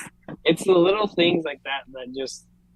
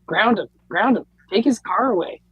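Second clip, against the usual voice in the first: higher, louder bratty cheering; gleeful sibling egging on; 7 semitones higher, 6 dB louder than usual. Retold or imitated. Kid egging parents to punish a sibling, gleeful. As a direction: egg the parents on like a gloating kid. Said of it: "you're over there in the back".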